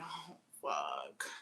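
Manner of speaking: a dismayed whisper; whispered dread. Pretend. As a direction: breathe it out in horror. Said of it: "I'd be like".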